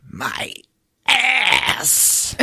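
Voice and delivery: strained, high cry on the last word; pause, then wail the second word; monster in pain. Acted. Monster walking about holding his behind, high pained wail. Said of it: "he's like".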